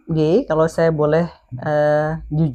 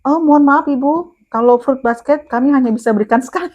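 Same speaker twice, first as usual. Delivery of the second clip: staff's rigid reply; 8 semitones higher than usual. Retold or imitated. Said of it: "Dia tahu nggak jawaban, eh, tim saya apa".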